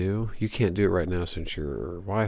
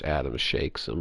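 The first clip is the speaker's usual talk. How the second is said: ordinary delivery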